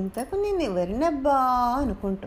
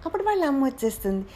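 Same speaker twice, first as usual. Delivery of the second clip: plain reading voice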